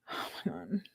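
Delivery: barely audible, whispered disbelief; shocked whisper. Real, not acted. Reader learning that he's crying now, shocked whisper. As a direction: whisper it to yourself, aghast.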